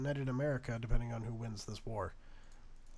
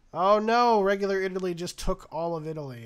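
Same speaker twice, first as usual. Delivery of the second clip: loud, high burst of alarm; alarmed exclamation; 7 semitones higher, 12 dB louder than usual. Real, not acted. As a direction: yelp at the sudden turn. Sudden game reversal.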